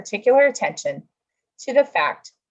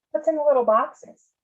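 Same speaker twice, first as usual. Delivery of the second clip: put-on higher, curious child asking grandpa; a small girl peeking at the boxes; curious little girl, higher; 8 semitones higher than usual. Voices the girl in story.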